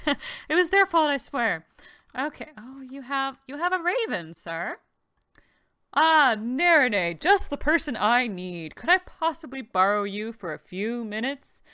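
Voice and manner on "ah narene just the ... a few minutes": loud, friendly townsman voice; NPC voice. Acted. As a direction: voice the quest giver eagerly. Reads Lucas NPC dialogue.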